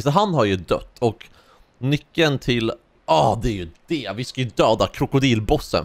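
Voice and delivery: usual speaking voice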